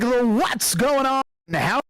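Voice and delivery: a hint of a vintage radio announcer; old radio host. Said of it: "is that what you guys think I cast like".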